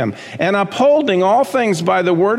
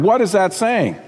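ordinary delivery

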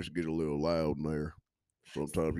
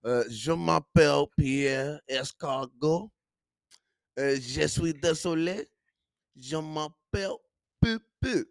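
hammed-up French accent, raised pitch; mock French accent; 5 semitones higher, 4 dB louder, slower than usual. Comedian introduces himself to podcast listeners in a mock French accent. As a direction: play the fake Frenchman, Pierre Escargot. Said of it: "he does that on stage".

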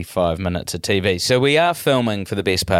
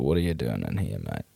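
grumpy local; 9 semitones lower, 9 dB quieter, faster than usual. Retold or imitated. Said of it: "You go into a pub in Greymouth".

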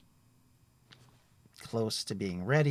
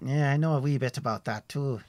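higher, louder, hearty traveller's voice; a cheerful forest ranger chatting; friendly woodsman Tim; 5 semitones higher, 6 dB louder than usual. Acted. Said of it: "He smiles at that".

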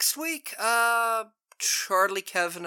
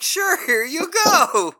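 mock cheerful; 11 semitones higher, 8 dB louder, slower than usual. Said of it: "And I was like".

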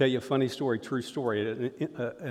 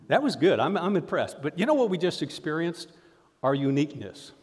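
normal talking voice

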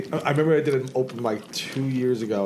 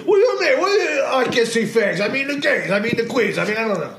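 mocking a kid comic; 6 semitones higher than usual. Mocking the kid's offstage rant.

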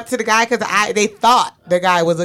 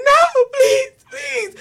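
much higher, half-laughing retold begging; tearful pleading; 4 dB louder, much slower than usual. Telling friends how she begged her boyfriend, high tearful plea. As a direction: beg him to stop, voice up high and teary. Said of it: "I'm crying and pleading with him".

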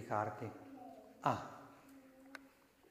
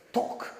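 loud, sudden "toc" knock; imitated stone thud; 5 dB louder than usual. Joke teller imitates the thrown stone hitting, sudden loud knock.

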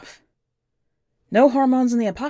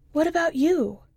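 put-on higher young girl's voice, plain and matter-of-fact; the kid's voice; 9 semitones higher than usual. A narrator's reading. The kid telling the stranger about her parents, in a higher child voice. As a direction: lift the pitch to play the nine-year-old.

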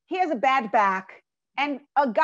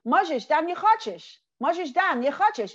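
"Mazish dan yechachish" in a Yiddish lilt, higher, every syllable leaned on; Yiddish saying; 3 semitones higher than usual. Retold or imitated.

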